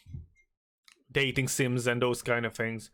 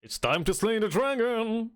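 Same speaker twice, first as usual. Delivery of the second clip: rallying cry; 8 semitones higher than usual. Acted. Rallying call to adventure.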